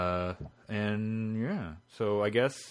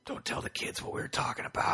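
hushed, secretive whisper; conspiratorial whisper; 7 dB quieter than usual. Real, not acted. Hushed secret as the children return.

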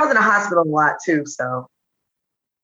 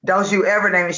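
usual speaking voice

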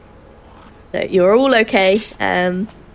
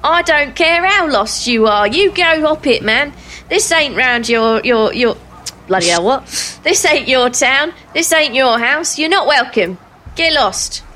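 disgruntled man; 4 semitones higher than usual. Acted. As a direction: chase them off angrily. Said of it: "the shutters open again and says".

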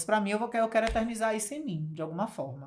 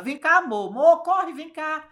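calling out; 7 semitones higher, 4 dB louder than usual. Retold or imitated. Man calling his wife over.

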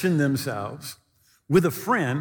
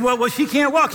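protesting call; 7 semitones higher, 5 dB louder than usual. Retold or imitated. Ladies protest to Wigglesworth.

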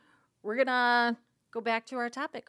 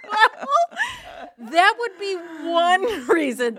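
laughing; 8 semitones higher, 10 dB louder, slower than usual. Real, not acted. Laughing after joke.

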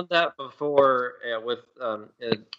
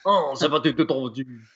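fake French; 4 dB louder than usual. Podcast friend showing off made-up French to the hosts, hammed-up. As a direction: pretend to speak French with gusto. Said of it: "that's pretty good".

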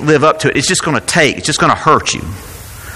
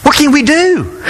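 despairing Israelites; 13 semitones higher than usual. Acted.

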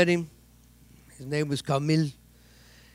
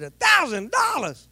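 shocked outburst; 10 semitones higher, slower than usual. Retold or imitated. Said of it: "Like that, I said".

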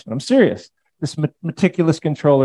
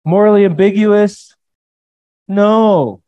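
louder, higher, firm no; emphatic rejection; 7 semitones higher, 6 dB louder, slower than usual. Real, not acted. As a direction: slam the idea down. Preacher rejecting view forcefully.

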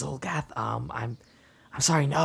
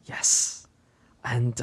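usual speaking voice

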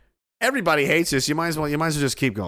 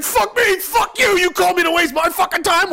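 high, loud outraged yelling; mock furious shout; 6 dB louder than usual. Caller screaming back at phone scammer, mock fury. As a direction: yell it in hammed-up indignation.

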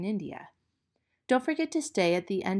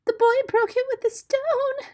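very high, loud cry; frantic high-pitched bird wail; shrieking; 7 dB louder than usual. Pretend. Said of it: "shrieked Darzee's wife".

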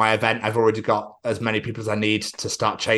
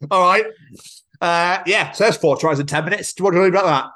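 higher, louder gloating; gloating fan; 7 semitones higher, 3 dB louder than usual. Pretend. Taunting New Zealand fans after a win, higher, gloating. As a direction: rub it in like a cocky fan. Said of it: "come out and be like".

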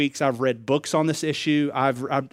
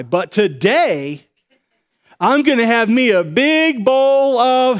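drawn-out, relishing higher voice with a sly grin; savoring indulgence; 8 semitones higher, 6 dB louder, slower than usual. Retold or imitated. Friend telling the pastor about his ice cream, savoring. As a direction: linger on every word like he can already taste it.

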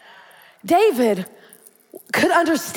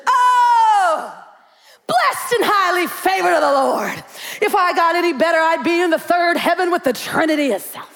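church-lady exultation. Retold or imitated. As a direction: proclaim blessings like a beaming church lady. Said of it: "relationship with Jesus".

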